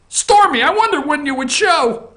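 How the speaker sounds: high, theatrical greeting; a hammed-up prisoner's welcome; mock Avenatti greeting.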